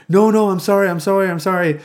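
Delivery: put-on high, loud frantic kid begging; pleading kid. Neighbor kid begging friends to stay, high frantic pleading. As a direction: beg them not to leave, voice jumping up.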